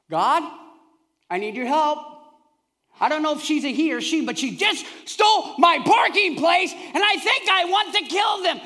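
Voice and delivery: mock enraged driver praying; angry prayer. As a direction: shout at God over the parking spot. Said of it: "what I did the other morning at Walmart".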